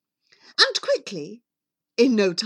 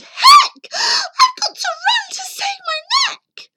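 very high, loud shriek; panicked shout; 9 dB louder than usual. Acted. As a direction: screech it in a rush to escape. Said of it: "She shouted".

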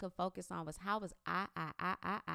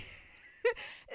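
unvoiced laugh. Real, not acted. Laughing at own claim.

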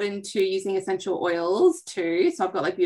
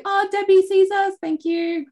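excited; 12 semitones higher, 5 dB louder than usual. Real, not acted. Spots a viewer live.

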